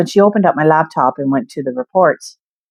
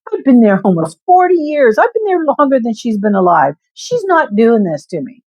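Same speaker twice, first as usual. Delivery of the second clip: higher, indignant inner voice; defiant vow; 6 semitones higher than usual. Retold or imitated. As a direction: swear she won't win. Defiant inner vow against boss.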